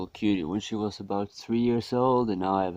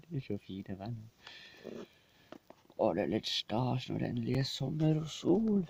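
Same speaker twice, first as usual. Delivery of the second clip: pet talk; 5 semitones higher, 5 dB quieter than usual. Real, not acted. Talking sweetly to the cat.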